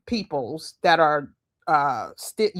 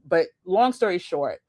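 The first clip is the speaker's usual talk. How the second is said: ordinary delivery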